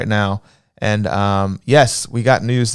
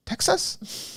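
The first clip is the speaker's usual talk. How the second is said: high, soft incredulous question; imitated disbelieving waitress; 11 dB quieter than usual. Host voicing an Italian waitress hearing Texas, high mock disbelief. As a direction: squeak it quietly in mock disbelief. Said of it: "she did the same thing".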